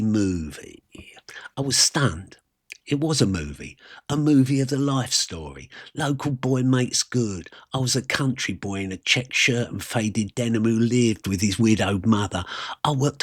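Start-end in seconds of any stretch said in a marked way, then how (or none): none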